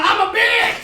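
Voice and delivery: high-pitched yelled confession; comic high yell, rising at the end; mock car yell. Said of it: "my car's gonna yell".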